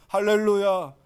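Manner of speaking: ordinary delivery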